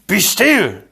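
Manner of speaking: high, loud, sharp order; commanding. A narrator's reading. Inigo ordering the trembling Fezzik, sharp command. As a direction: command it with sudden force. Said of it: "Inigo commanded".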